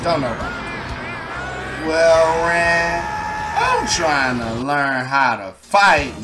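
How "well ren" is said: ordinary talk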